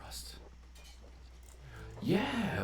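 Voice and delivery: ordinary talk, nothing marked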